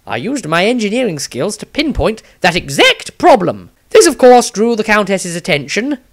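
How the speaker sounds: an anxious, quick-talking engineer mage telling what happened; nervous wizard. Acted. Maestro's diary, another figure.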